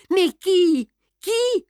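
high, anguished questions; wailing. A narrator's reading. Isidore despairs over who tore the book, wailing. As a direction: wail it, pitch soaring. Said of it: "gémissait Isidore en se tordant les poings".

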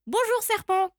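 high little mouse greeting; high-pitched mouse hello; cheery mouse call. Acted. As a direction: call out brightly to the snake. Mouse calls out to snake.

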